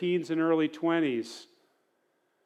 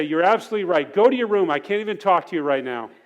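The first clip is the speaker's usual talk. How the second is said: louder, clipped outburst with a laugh breaking through; hammed-up fed-up father; mock angry dad; 2 semitones higher, 6 dB louder, faster than usual. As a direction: replay the blow-up half-laughing at yourself. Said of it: "that made me even madder".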